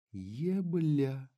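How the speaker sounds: drawn-out seductive Ебля, slow and soft. Acted. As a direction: stretch the word out very slowly, lingering. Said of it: "очень медленно произнесла Лилли".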